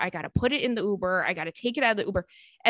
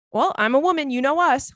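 higher, louder hammed-up boast; mock proud; 5 semitones higher, 4 dB louder than usual. Mocking what she told the man, hammed-up boast. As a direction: throw it out with mock confidence. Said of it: "acting out the line again".

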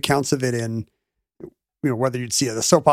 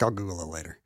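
lower, softer offhand brush-off; dismissive psychiatrist; 8 semitones lower, 11 dB quieter, faster than usual. Retold or imitated. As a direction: shrug it off like a busy doctor. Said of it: "And he's like".

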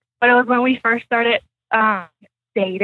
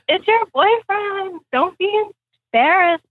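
reassuring friend; 7 semitones higher than usual. Retold or imitated. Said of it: "and then they were like".

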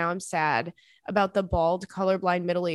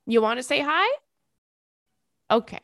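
high, cooing pet voice, a bit louder; talking to the dog; 5 semitones higher, 3 dB louder than usual. Real, not acted. To Stanley the dog, high cooing pet talk. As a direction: coo at the dog to say hi.

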